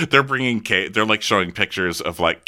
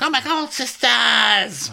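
put-on very high, drawn-out girly squeal; hammed-up, slow high-pitched gushing; mock squeal; slower than usual. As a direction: imitate two overexcited friends shrieking together. Mocking the two women's shared squeal.

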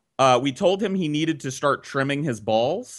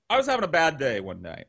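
usual speaking voice

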